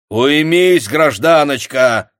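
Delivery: loud stern rebuke, slow on the last word; sharp shout. A narrator's reading. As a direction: bark her into silence. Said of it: "прикрикнул на неё Самойлов". (строгий окрик)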